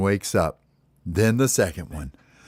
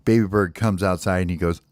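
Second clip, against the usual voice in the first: ordinary delivery, nothing marked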